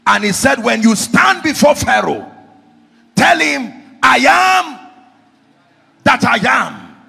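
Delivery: full-force preaching. Real, not acted. Preacher telling of Moses asking God's name, full-force preaching. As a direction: thunder it out to the whole congregation.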